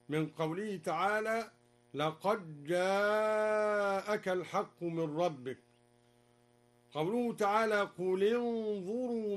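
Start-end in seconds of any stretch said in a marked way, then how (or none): 1.9-5.6 "لقد جاءك" run together as laqajjaa-aka; slow recitation, doubled j and long held aa in "جاءك"; dal merged into jim. Shown as a demonstration. Teacher showing idgham, "لقد جاءك" said laqajjaa-aka. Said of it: "وأدغم الدال في الجيم".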